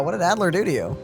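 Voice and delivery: usual speaking voice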